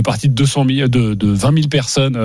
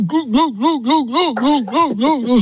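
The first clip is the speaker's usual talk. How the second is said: high mock gulping noises; gulping sounds; 10 semitones higher, slower than usual. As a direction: glug it down in a high voice. Gulping rosé in the night.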